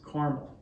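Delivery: "caramel" in two syllables, CAR-mul; Kansas-style caramel. Shown as a demonstration. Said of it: "you're from Western Kansas, you might pronounce it".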